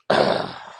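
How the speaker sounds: a low, satisfied sigh, far down in the throat; comfortable deep sigh. Retold or imitated. Uncle sinking into the couch, a low contented sigh. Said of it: "He made a sound".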